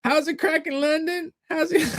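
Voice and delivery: very high-pitched imitation of a friendly American greeter; grocery store woman. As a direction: call out a high, sing-out hello. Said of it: "the woman would say".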